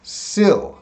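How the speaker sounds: "Sil" on its own, pitched high; "Sil" cut off from the rest of syllable; first syllable alone. Shown as a demonstration. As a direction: isolate "Sil" as a teacher would. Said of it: "That's the first vowel sound".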